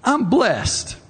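bright high beaming "I'm blessed"; cheerful stranger beaming his answer. Retold or imitated. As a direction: say it grinning ear to ear. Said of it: "with a great big grin on their face".

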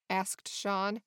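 ordinary delivery

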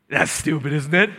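low, dismissive sneer; mocking devil. Devil mocking God's word to you, low scorn. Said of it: "he'll just mock God's logos".

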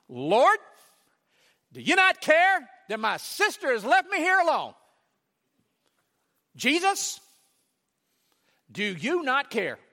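high, loud, aggrieved plea; indignant complaint. Retold or imitated. Martha complaining to Jesus about her sister. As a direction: demand he take your side.

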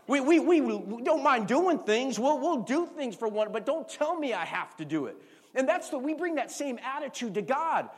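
preaching at full force. Acted. Pastor tells congregation love means obeying, full-force preaching. Preacher drives home obedience.

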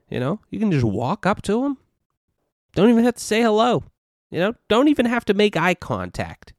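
hammed-up brag, pushing up in pitch; mock Trump boast. Mocking Trump's groping boast to viewers, sarcastic swagger.

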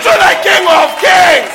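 high, loud ringing proclamation; preaching at full force. Acted. Preacher rousing the church, shouting praise. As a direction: thunder it to the church.

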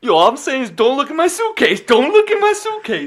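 put-on loud, high, agitated old guy. Old guy protecting his suitcase, loud and agitated. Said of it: "you be the old guy".

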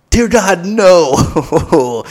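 loud, high, hammed-up dread; pleading, exaggerated refusal; mock horror. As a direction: recoil in comic horror at the offer. Acted horror at offer of another dance.

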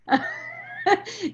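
a sudden high burst of laughter. Real, not acted. Mother laughing after retelling her daughter's confession.

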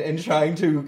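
ordinary talk, nothing marked

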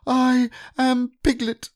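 very high, slow squeaky insistence; a piglet insisting on his name; loud protest. Acted. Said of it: "said Piglet loudly".

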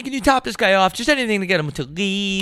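high pleading voice; hammed-up begging; a hint of panicked boyfriend; mock plea. Acted plea to girlfriend.

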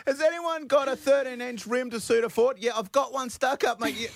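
high joking bloke voice; mock caller voice. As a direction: play a cheeky caller, up high. Host acts out a mock caller.

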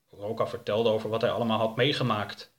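ordinary delivery

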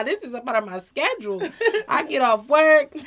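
high, loud, laughing through the words; giddy laughter breaking into the words; laughing excitedly. Real, not acted. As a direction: gush about it with a laugh. Eager about her therapy sessions.

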